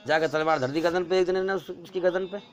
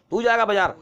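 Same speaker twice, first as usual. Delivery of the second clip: loud, higher commanding bark; bossy order; 5 semitones higher, 6 dB louder, faster than usual. Acted. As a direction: push him off to the bazaar. Robbers order the third man. (اونچی آواز میں رعب دار حکم)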